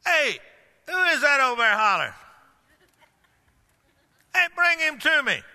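a lightly voiced Jesus hailing someone far off; Jesus calling out. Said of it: "Jesus said".

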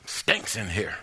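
put-on old grandpa grumbling. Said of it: "He said".

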